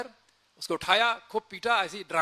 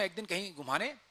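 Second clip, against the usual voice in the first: ordinary delivery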